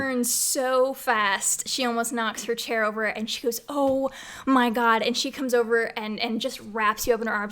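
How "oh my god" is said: loud, drawn-out cry of relief; startled relief. Acted. Mom to son just come home, startled relief. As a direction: exclaim it slowly and loudly, overcome.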